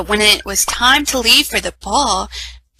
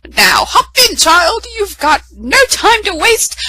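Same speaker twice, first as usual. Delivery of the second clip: fairy godmother; 10 semitones higher, 4 dB louder than usual. A narrator's reading. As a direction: announce yourself with magic. Fairy godmother sends her off.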